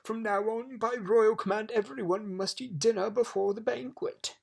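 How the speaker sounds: high, loud royal decree; shouting king. A narrator's reading. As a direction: thunder out an indignant command. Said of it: "shouted the king".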